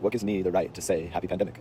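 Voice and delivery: softening, thoughtful drawl; pondering. Retold or imitated. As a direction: turn the question over slowly, as if to yourself. Echoing the challenge back, pondering it.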